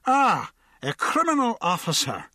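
put-on muddled drunk welcome; drunken Irishman. A narrator's reading. Drunk hails the constable, muddled and tipsy. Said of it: "said the drunk".

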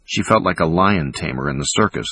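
plain reading voice

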